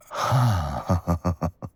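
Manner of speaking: a full, amused laugh; delighted laugh. Acted. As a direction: laugh with pleasure at the challenge. Enjoying the intruder's defiance.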